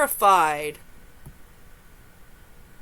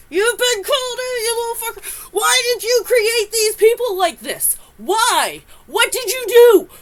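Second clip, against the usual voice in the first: laughing yell; 11 semitones higher, 8 dB louder than usual. Real, not acted. Yelling at the storyteller, laughing.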